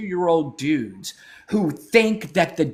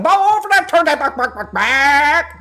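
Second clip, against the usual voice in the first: high, loud call across the room; 10 semitones higher, 6 dB louder than usual. Real, not acted. Host calling out to the crew, raised and high. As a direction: call it out over the noise.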